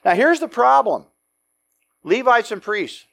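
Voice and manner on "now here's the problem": ordinary talk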